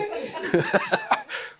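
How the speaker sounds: a burst of high laughter. Real, not acted. Speaker laughs telling the audience about his daughter's note. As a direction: laugh out at the irony.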